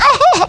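high-pitched, exaggerated boo-hoo; hammed-up burst of weeping; mock crying wail. Preacher mimicking someone crying over Frosty melting, mock wail. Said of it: "can cry at the drop of a hat".

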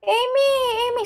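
high mock fan call; tiling fan. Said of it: "sumisigaw lang sila". (ginagayang tawag ng fan, mataas ang boses)

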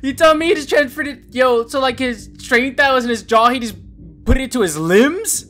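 high and loud disbelief; incredulous rant. Real, not acted. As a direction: argue it like it's absurd. Incredulous about transferred strength.

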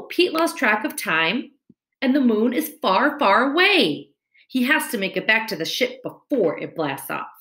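ordinary delivery

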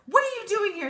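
loud, high startled call-out. Acted. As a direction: call after the fleeing bird. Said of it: "she called out".

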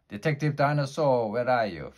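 loud high call across the park. Acted. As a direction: call out searching in the fog. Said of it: "called Officer Pterodactyl".

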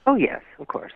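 usual speaking voice

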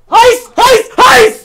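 high, loud repeated yelps; real yelp at the heat; alarmed yelping. Real, not acted. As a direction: cry out as if scalded. Yelping that something is hot.

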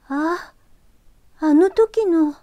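high, startled recognition; surprised girl. Acted. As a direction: gasp it out in surprise. ユキが男の子に気づく. (驚いた少女の声)

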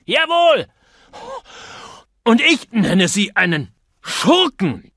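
high shouted insult, slowing on the name; panting, hurl it at him; gasping with rage. A narrator's reading. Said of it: "keuchte Mister Copley".

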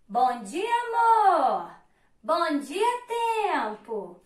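loud bright call, pitch jumping up on amor; cheerful greeting. Acted. Boat greets Love, loud cheerful call. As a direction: hail them across the water.

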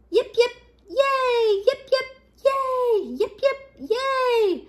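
high, drawn-out yays; cheering. A narrator's reading. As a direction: chant three cheers with soaring yays. Crowd's three cheers.

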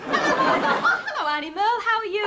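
high, gushing hello with a laugh; bright greeting. Acted. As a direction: sing out the hello to your aunt. Greets aunt before hearing news.

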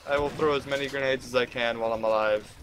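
ordinary talk

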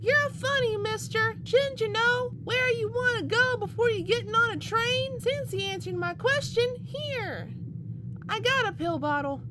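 put-on high, bratty little girl teasing the stranger; a cheeky little girl talking to a stranger on the train.

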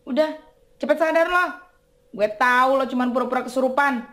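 loud angry order; shouting. Acted. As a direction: yell at him to snap out. Collector orders fake possessed to wake.

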